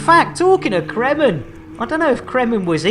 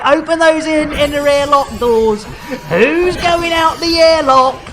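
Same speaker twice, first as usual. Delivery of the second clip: louder, higher, building up the big moment; excited announcement; 5 semitones higher, 6 dB louder than usual. Real, not acted. Streamer to his chat, announcing the airlock: louder, higher, excited. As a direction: hype it like a showman.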